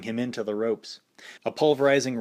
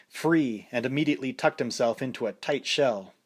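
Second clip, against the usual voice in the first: ordinary delivery, nothing marked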